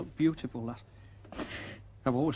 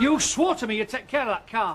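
angry; 6 semitones higher, 6 dB louder than usual. Acted. Angry husband over crashed car.

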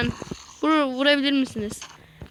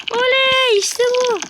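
much higher, louder joyful whoop; cheering; 13 semitones higher, 8 dB louder, slower than usual. Real, not acted. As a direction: whoop with delight. Cheering at success.

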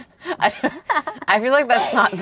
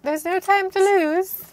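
put-on high, slow British accent; hammed-up high British voice; 6 semitones higher, slower than usual. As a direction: stretch it out in mock British. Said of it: "talk in a British accent from now on".

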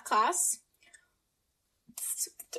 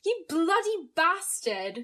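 slow, higher mock British; hammed-up attempt at a British accent; badly faked British insult, drawn out; 5 semitones higher, slower than usual. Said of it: "be, like, British".

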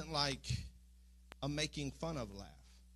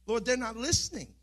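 higher, louder frustrated complaint to God; exasperated plea; 7 semitones higher, 6 dB louder than usual. Retold or imitated. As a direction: cry out that nobody hears. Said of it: "And I kept saying in my mind".

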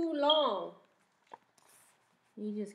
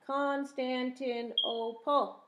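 slow, spelled out syllable by syllable; 2 semitones higher, slower than usual. Shown as a demonstration. Teacher showing the class the syllables, slow. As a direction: stretch Constantinople so the kids can count each beat. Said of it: "Five syllables".

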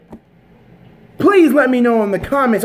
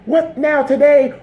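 ordinary delivery

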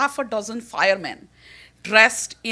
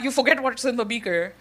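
normal talking voice

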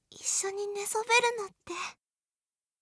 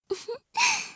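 short rising giggle; playful giggle; 11 semitones higher than usual. Acted. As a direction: giggle mischievously.

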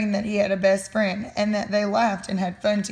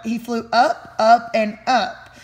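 louder and slower with each up; rising excitement; 5 dB louder, slower than usual. A narrator's reading. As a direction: let it climb and swell. Butterfly soaring higher.

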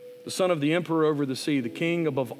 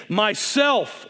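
louder and higher, ringing; loud and clear; 6 semitones higher, 4 dB louder than usual. A narrator's reading. The lion answering the boy again, loud and clear. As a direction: proclaim it clearly. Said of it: "loud and clear".

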